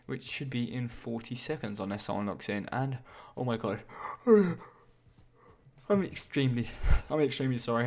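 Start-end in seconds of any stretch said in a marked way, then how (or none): 3.4-6.7 slow words through a yawn. Real, not acted. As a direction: yawn and sigh while talking. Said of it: "I shouldn't keep yawning".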